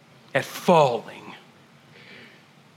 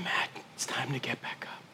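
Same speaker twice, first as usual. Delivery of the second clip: soft, tender whisper; gentle whisper; 5 semitones lower, 17 dB quieter than usual. A narrator's reading. Holy Spirit gently telling the pastor, in a whisper. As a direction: hush it gently like a comforter. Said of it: "because he's so gentle".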